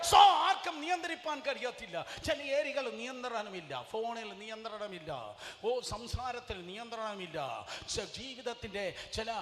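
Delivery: high, loud pulpit shout; shouted preaching. Acted. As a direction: thunder it at the congregation.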